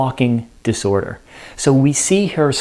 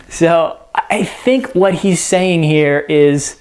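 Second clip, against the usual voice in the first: ordinary talk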